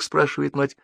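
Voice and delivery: ordinary delivery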